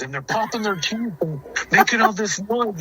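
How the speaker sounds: high, spooked mock-kid voice; imitating scared brother. Little brother describing the noisy animals, imitated as scared. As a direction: pitch it up like a rattled youngster. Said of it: "scared to death".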